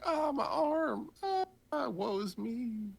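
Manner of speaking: high, slow, hammed-up cry of pain; mock anguished wail. Retelling the hero bitten by the snake, hammed-up wail. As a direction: overplay the hurt like a melodrama. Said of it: "He's like".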